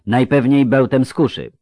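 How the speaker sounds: mock the hope of hospitality; sarcastic. A narrator's reading. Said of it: "rzekł z przekąsem Kahir". (z przekąsem)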